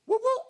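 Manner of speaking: high whoop-whoop police siren; siren imitation. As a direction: chirp it like a cop siren, high.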